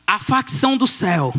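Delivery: normal talking voice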